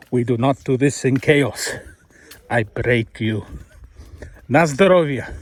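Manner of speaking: low, mock Russian villain accent. Man joking about the Russian in him, mock Russian accent. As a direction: put on a deep Russian accent.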